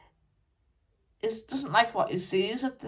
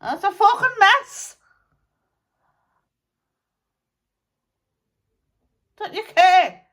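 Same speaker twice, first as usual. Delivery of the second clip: higher, louder exasperated shout; angry outburst; 13 semitones higher, 13 dB louder than usual. Acted. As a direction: fling it at the crowd in disgust. Spirit berates today's people.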